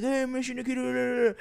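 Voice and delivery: high, drawn-out mock greeting; imitating the TikToker. Host mimics TikToker greeting friend on a video call, high drawn-out imitation. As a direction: stretch out a high, put-on hello. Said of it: "He's like".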